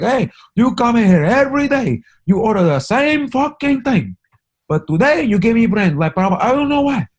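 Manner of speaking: high, loud put-on clerk voice, way up in pitch; mock store clerk. Retold comedian joke, clerk snapping at customer in high mock voice. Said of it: "He's like".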